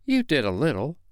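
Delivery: lightly dismissive and self-absorbed; careless. A narrator's reading. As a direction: toss it off carelessly. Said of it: "Peter said carelessly".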